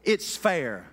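ordinary delivery

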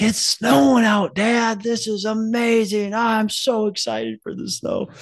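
put-on high, slow thrilled little-boy voice; excited kid. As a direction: squeal it like a kid seeing snow. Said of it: "He's like".

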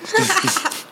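a short, high real laugh; high burst of laughter. Real, not acted. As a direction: laugh at his own line. Laughs at his own phrasing.

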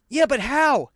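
high-pitched, baffled protest; incredulous questioning. Acted. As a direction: challenge him like a disbelieving buddy. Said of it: "and you're almost like".

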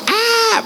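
high thin scream; movie scream. Shown as a demonstration. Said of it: "they're always like".

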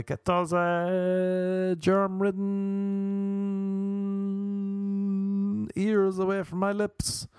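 high, drawn-out silly voice; high goofy whining voice; silly mock voice. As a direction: stretch germ-ridden out in a goofy whine.

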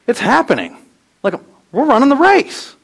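rising, thrilled disbelief; breathless wonder at the start line; high and bright with excitement; excited. Retold or imitated. Excited runner at race start.

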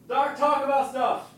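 high, distant holler; calling from afar. Shown as a demonstration. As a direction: call out orders from the kitchen. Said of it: "go to the kitchen and yell".

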